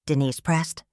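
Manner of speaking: ordinary delivery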